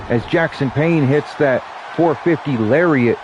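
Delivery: lively ringside announcer calling the action; play-by-play commentary. Acted. Streamer calling the wrestling match for viewers, announcer style. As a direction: call the match like a wrestling commentator, stretching the names.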